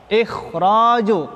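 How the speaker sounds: ikhraju slow, the kh and r heavy and full-mouthed; thick tafkhim reading. Shown as a demonstration. Teacher models the correct heavy ikhraju for students, slow and thick. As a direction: draw out ikhraju with a thick, dark kh and r. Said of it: "tebal dia dibacanya".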